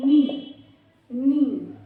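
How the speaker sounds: नी called out loud and clear for the class; loud model word. Shown as a demonstration. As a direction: say नी loudly so they repeat it. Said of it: "Speak after me".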